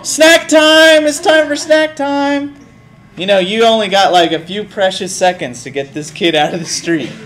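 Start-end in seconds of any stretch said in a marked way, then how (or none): 0.0-2.6 high, slow sing-song call; excited announcing. Acted. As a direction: announce it like a treat. Luring kid back with snacks.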